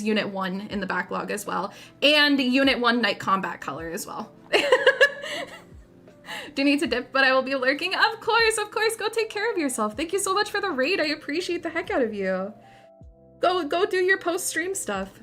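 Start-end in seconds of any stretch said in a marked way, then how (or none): 4.5-5.5 squeaky high giggle. Real, not acted. High laugh. 7.9-15.1 high bubbly thank-you voice; cute anime streamer. Acted. Cute farewell to raider.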